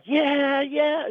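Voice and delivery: high-pitched mock kid cheering; imitated excited cheer. Acting out the child having great fun.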